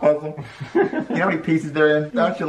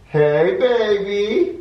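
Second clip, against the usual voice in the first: smooth flirt; 8 semitones higher, 3 dB louder, much slower than usual. Pretend. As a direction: greet her like a smooth lover. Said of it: "Yeah, good".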